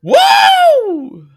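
high, loud whoop; excited woo. Real, not acted. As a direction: let out a wild whoop. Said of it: "I'm feeling a little crazy".

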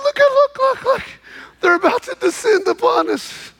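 high, frantic repeated look; panicked urging. Retold or imitated. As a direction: urge him in alarm to see the army. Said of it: "and he goes".

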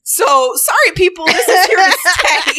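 high, giggly apology; giggling. Real, not acted. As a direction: apologise while cracking up.

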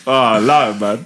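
usual speaking voice

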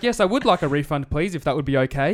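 mock-prim, matching the bot's tone; sarcastically polite robot voice; robot back. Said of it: "getting the shits with Robo Girl".

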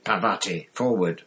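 ordinary delivery, nothing marked

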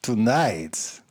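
slow, hammed-up Cajun French accent. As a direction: draw it out in a mock French accent. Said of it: "say a few words to work up to it".

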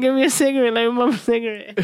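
put-on high-pitched Bronx girl voice; Bronx accent. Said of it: "in her Bronx accent".

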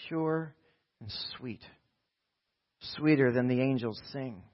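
measured, dramatic storytelling with pauses; poem recitation. Acted. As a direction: recite the old auction tale to a congregation.

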